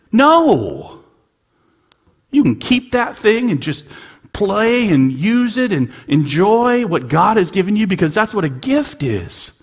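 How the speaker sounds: loud, high burst then driving on; emphatic shout. Real, not acted. Emphatic preaching.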